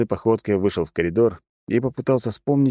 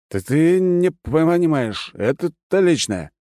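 put-on drunk slurring; 9 semitones higher, 3 dB louder, slower than usual. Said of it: "Язык у меня сильно заплетыкался".

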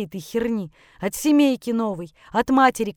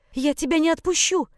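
high-pitched desperate insistence; desperate refusal; 7 semitones higher than usual. Acted. Mother grabs son's jacket, refusing. (отчаянно, высоким голосом)

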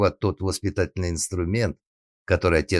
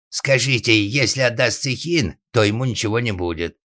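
raised, higher pleading voice; calling out; 6 semitones higher, 5 dB louder than usual. Acted. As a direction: shout an offer across the fence. Father calls out from behind fence.

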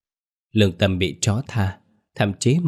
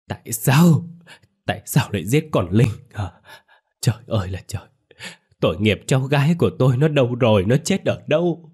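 higher wailing cries; crying aloud; 4 semitones higher than usual. A narrator's reading. Grandmother grieving her murdered granddaughter, crying aloud higher. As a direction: wail out the questions in grief. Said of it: "bà khóc thành tiếng".

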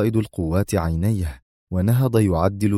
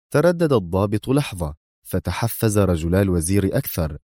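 plain reading voice

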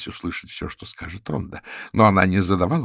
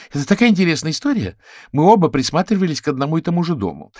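high, fast, gushing character voice; chatty raccoon woman, eager; 9 semitones higher, 4 dB louder, faster than usual. Acted. Raccoon tells how she met her lover, fast and eager. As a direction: rush eagerly into the story, high-pitched.

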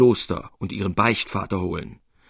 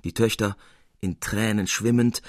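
ordinary delivery, nothing marked

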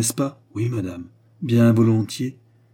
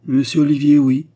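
loud, higher-pitched puzzled answer; astonished confirmation; 5 semitones higher, 9 dB louder than usual. A narrator's reading. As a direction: confirm it, taken aback by the reaction. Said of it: "dit la reine en regardant la jeune fille avec étonnement".

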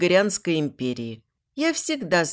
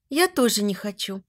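plain reading voice

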